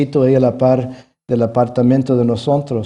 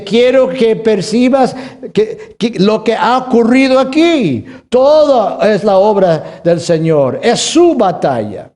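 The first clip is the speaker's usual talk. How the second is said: loud, high preaching, slowed; preaching God's words; 9 semitones higher, 4 dB louder, slower than usual. Acted. Pastor voicing God to the church, fervent proclaiming. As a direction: proclaim it from the pulpit.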